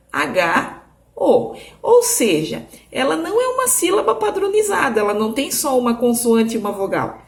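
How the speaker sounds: usual speaking voice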